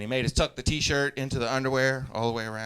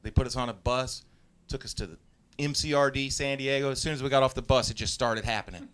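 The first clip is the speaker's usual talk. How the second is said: normal talking voice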